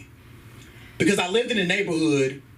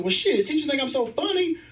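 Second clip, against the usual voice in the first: high, pushy comeback; mock-indignant high voice; cheeky retort; 11 semitones higher, faster than usual. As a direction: pitch it up like you're boldly shooting your shot. Acts out shooting his shot.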